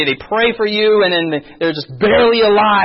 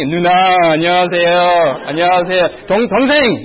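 mock age-based greeting; 4 dB louder, much faster than usual. Switching manners by relative age.

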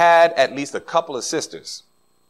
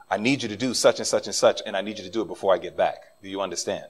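father's low, quiet second warning; stern repeat; 8 semitones lower, 7 dB quieter, faster than usual. Retold or imitated. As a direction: lower and softer, deadly serious father.

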